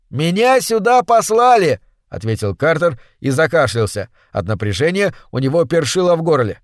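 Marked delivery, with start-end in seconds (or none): 0.1-1.8 shouting. A narrator's reading. Shouting over waterfall noise.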